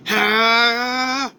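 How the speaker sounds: sustained high battle cry, "haaa"; power-up yell. Acted. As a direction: let out a drawn-out power-up cry. Fighter powering up.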